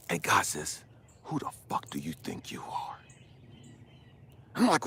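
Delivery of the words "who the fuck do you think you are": low whispery voice of God; quiet, low confrontation; hushed stern rebuke. Retold or imitated. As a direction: challenge very softly, with weight. God's quiet rebuke, acted.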